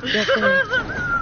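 high squeaky yeah. Real, not acted. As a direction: pitch the yeah way up. High squeaky yeah while gaming.

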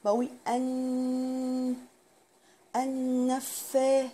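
النفا with a long nasal n; held ghunna. Shown as a demonstration. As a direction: draw out the n through the nose. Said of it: "بطول في الحرف شوية". (إطالة الغنة في النفا)

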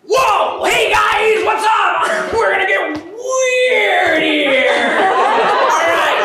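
loud hyped bro greeting; frat bro. Acted. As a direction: pump up the crowd, shouting. Host bursts on stage, hyping crowd.

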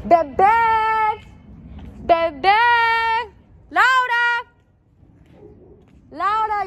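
yelling her pet name and name outside; calling out. Acted. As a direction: holler up at the apartments.

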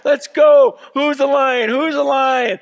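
high, loud urgent coaching yell; coaching shout. Acted. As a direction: fire up the lion like a coach. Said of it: "he's trying to run with the lion and go".

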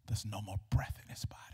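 low, unvoiced storytelling hush; hushed whisper. A narrator's reading. Preacher tells of the boy's death, whispering. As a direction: drop to a grave whisper.

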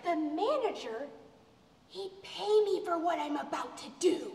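low, slow, soft threat; hushed, deliberate menace; quiet menace. Acted. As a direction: drop the voice and threaten slowly. Quiet threat in acted quarrel.

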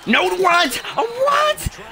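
high, loud disbelief; incredulous high-pitched yelling; shocked shout. Real, not acted. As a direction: yell out, stunned by the call. Shocked by play in game.

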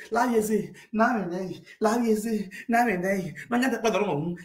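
normal talking voice

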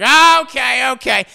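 loud, high-pitched cut-in; mock brush-off. Mentor cuts off talk of dreams, mock brush-off. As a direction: retell it as a loud, drawn "okay, okay".

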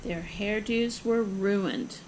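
ordinary delivery, nothing marked

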